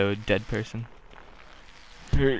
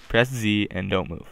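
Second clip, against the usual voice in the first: normal talking voice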